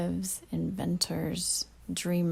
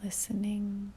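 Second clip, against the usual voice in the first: calm meditation delivery with long pauses; guided meditation; 5 dB quieter than usual. Real, not acted. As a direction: ease the listener inward, leaving long silences between phrases.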